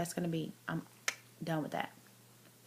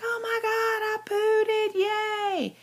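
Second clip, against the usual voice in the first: very high, loud giddy squeal; excited squeal; 7 dB louder, much slower than usual. Retold or imitated. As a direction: squeal with joy like a kid. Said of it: "And I was like".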